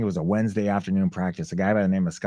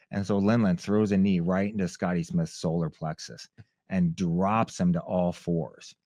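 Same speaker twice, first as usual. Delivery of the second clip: usual speaking voice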